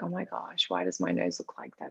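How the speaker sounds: hushed, low, dismayed at her own face; mock dismay. Watching her own early videos, quiet dismay. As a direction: mutter in mild horror at yourself. Retold self-critique of videos.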